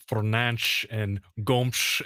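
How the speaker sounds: Fernanch and Gomsh, ending in slushy "sh" sounds; imitated Portuguese pronunciation. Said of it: "he keeps saying".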